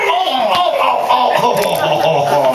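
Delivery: high wincing oh's; pained groans. Real, not acted. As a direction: wince at each blow. Reacting to brutal hits.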